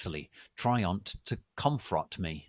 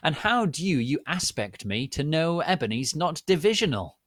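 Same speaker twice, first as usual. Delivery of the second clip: higher, mocking question; mocking Dumbledore; 7 semitones higher, 6 dB louder than usual. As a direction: scoff at her. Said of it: "Dumbledore mocking".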